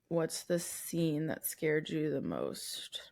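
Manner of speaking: slow, eerie whisper. Pretend. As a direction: breathe it out slowly and spookily. Re-reading the question, spooky aside.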